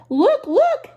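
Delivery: high, loud alarmed cry; alarmed fish. A narrator's reading. The fish warns the children, loud and panicked. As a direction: yelp in panic like a frightened fish. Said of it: "Then our fish said".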